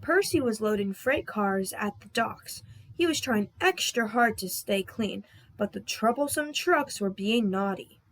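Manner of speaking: ordinary delivery, nothing marked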